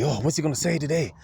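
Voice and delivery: mock her puppy-like hope, lightly voiced; eager puppy. Imitating the clerk waiting like a puppy, lightly eager. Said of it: "like a little puppy".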